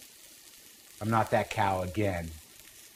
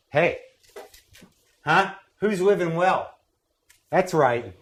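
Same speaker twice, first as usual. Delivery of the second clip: gloating; 7 semitones higher, 4 dB louder, slower than usual. Real, not acted. Gloating about living well.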